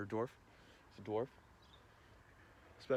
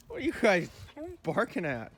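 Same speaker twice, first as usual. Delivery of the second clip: high dog-talk voice; 6 dB louder than usual. Real, not acted. Farmer greeting his dogs in a high, loud doggy voice.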